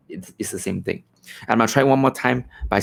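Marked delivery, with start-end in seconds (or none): 0.1-1.0 turned away from the mic, sound thins and drops; off-axis, distant mic sound. Shown as a demonstration. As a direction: speak past the mic to the right side. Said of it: "So if I speak to the right".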